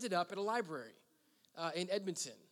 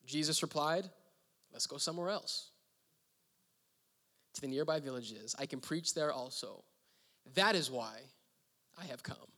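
scripture reading; 4 dB louder than usual. Acted. Pastor reading Mark to congregation, measured reading. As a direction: read the verses with weight.

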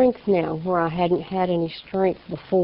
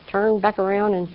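normal talking voice

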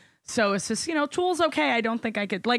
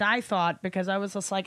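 ordinary talk, nothing marked

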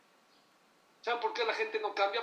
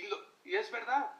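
ordinary talk, nothing marked

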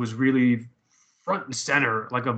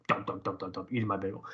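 low, soft mock chomping; imitated oblivious munching; 4 semitones lower, 11 dB quieter, faster than usual. Bagel eater miming oblivious chewing, low and soft. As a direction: mumble dull chewing beats under your breath. Mimes chewing through awkward news.

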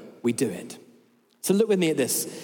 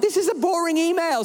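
exasperated; 12 semitones higher, 3 dB louder than usual. Retold or imitated. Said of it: "And I'm like".

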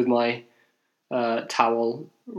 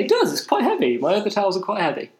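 much higher, sarcastic insistence, quickening at the end; mock-earnest agreement; 8 semitones higher, faster than usual. Answering his friend's teasing about towel weight, with sarcastic mock agreement. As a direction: play dead serious about something silly.

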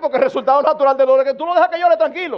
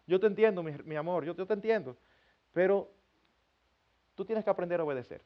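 soft, lower loving parent voice; tender reassurance; 3 semitones lower, 5 dB quieter than usual. Acted. Parent reassuring a disciplined child of love, tender and soft. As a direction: comfort a child gently, softening as you go.

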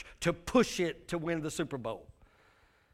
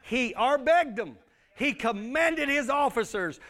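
ordinary talk

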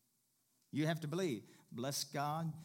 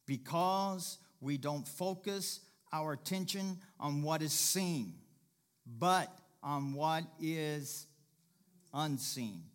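slower, each word weighed; slow emphatic repeat; slower than usual. Shown as a demonstration. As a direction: lean into it slowly, phrase by phrase. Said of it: "Say it one more time".

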